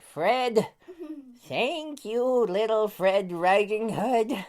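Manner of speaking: high, put-on granny voice, slowing at the end; grateful grandma. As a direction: thank him in a high grandmotherly voice. Grandma thanks her grandson.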